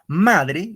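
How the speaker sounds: teacher marking the accent on MA in "Madre"; stress on MA. Shown as a demonstration. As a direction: hit MA in "Madre". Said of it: "el acento va en ma".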